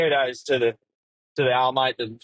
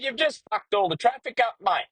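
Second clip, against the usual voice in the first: much higher, mock furious radio call; retold angry trucker; 11 semitones higher than usual. Trucker abusing the blocking driver, mock furious. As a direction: tear strips off him over the radio. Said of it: "He said".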